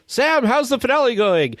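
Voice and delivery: high-pitched puppet question; Kermit. Retold or imitated. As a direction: pitch it up like an eager Muppet host. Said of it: "is when Kermit asks him".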